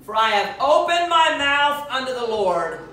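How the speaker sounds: anguished vow. Acted. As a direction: proclaim the vow with heavy despair. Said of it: "Look at what he says".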